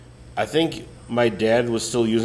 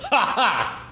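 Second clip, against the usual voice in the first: high barking ha ha; sudden bark laugh; 9 semitones higher than usual. Retold or imitated. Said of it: "I go".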